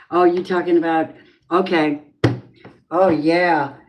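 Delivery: ordinary delivery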